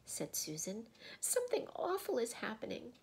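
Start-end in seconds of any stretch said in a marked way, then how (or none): 1.2-2.9 put-on high, worried girl's voice; frightened girl. A narrator's reading. Susan fears for Aslan, frightened high voice. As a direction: lift it high with dread.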